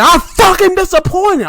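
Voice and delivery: furious high-pitched yell; going crazy. Retold or imitated. Imitating Chris knocking down the podium, crazed yell. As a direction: explode loudly on the swear word. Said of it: "he just started going crazy".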